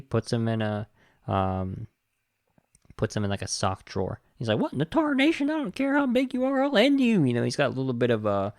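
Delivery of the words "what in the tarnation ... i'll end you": high-pitched blustering cartoon cowboy threat; hammed-up hot-tempered gunslinger impression; Yosemite Sam. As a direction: hold it high and build to a loud finish. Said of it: "Yosemite Sam in him".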